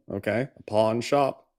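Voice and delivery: ordinary talk, nothing marked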